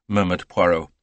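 plain reading voice

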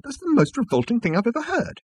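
high-pitched disgust; scandalised, voice pitched right up; appalled exclamation. Acted. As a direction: protest as if revolted. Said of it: "exclaimed Arthur".

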